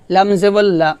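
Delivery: ordinary delivery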